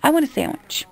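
high, mimicking customer voice; mock customer. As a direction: imitate a customer announcing an order. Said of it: "they come up to us and go".

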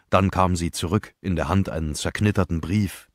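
usual speaking voice

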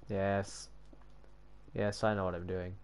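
quiet, low self-assurance; low mutter. Real, not acted. Reassuring self during the build, low and quiet. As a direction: say it softly to yourself. First take, under breath.

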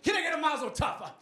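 high, shouted gospel-preacher call; hammed-up preacher rousing the pews. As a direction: whip the congregation up at full volume.

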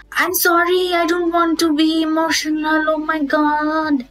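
high, hammed-up wail; mock tearful apology. Vlogger imitating someone sorry for being emotional, mock tearful apology. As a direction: wail the apology like a pretend breakdown.

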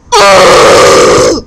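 loud low growling groan; frustrated groan. Real, not acted. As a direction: groan out in annoyance. Grito de frustración.

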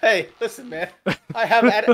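normal talking voice

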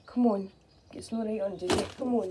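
ordinary delivery

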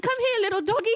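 high, loud cutesy call to a dog; mock dog coaxing. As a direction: tease him with a doggy-calling voice. Mocking his approach to a dog.

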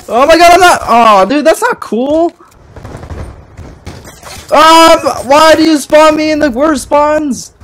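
high, loud exasperated yelling; whine-yell at the game; frustrated yelling. Real, not acted. Raging about spawns.

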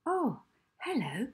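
high, friendly greeting; a bright, kind hello; kindly librarian. Acted. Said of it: "her kind blue eyes twinkling".

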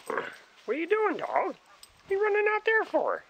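very high, sing-song voice for the dog; high excited pet talk. Real, not acted. Calling to dog in snow.

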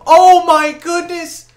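loud, high shout of amazement; hyped shouting. Real, not acted. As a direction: yell in disbelief at a great play. Hyped over a block.

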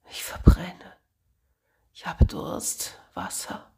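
hoarse whisper, fading to almost nothing; feverish whisper. Acted. Sick boy begging his friend for water, whispered. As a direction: whisper through a burning fever. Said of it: "flüsterte er".

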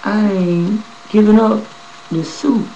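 high, slow, strained defiance; defiant refusal. Retold or imitated. To the officers, refusing to hand over his suit, defiantly. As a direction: dig in and refuse to give it up. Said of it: "I said".

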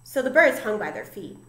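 ordinary delivery, nothing marked

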